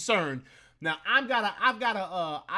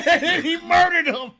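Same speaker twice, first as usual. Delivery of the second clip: louder, higher, laughing through the words; 9 semitones higher, 9 dB louder than usual. Real, not acted. Laughing with his co-host about the kill, louder. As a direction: crack up while telling it.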